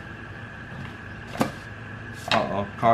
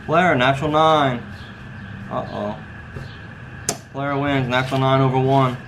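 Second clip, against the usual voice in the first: casino croupier. Acted. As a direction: announce the hand like a baccarat dealer. Dealer calls the baccarat hand.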